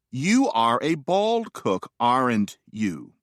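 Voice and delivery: slow, measured grammar example with a tag question; recited example sentence. Shown as a demonstration. Lecturer showing the pattern of tag questions, slow and measured. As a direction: recite it slowly, word by word.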